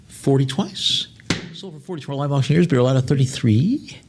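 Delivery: measured auction closing call; auctioneer bid call. Acted. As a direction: close the lot like an auctioneer. Auctioneer calling bids.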